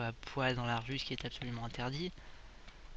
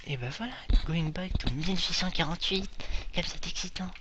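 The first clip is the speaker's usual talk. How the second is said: fast whispered sarcastic thrill; mock excitement; 5 semitones higher, 5 dB louder, faster than usual. Gamer mock-thrilled about going back to 1848, fast whisper. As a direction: hiss it like a fake thrilled secret.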